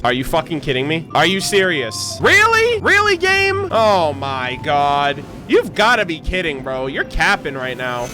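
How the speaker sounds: ordinary talk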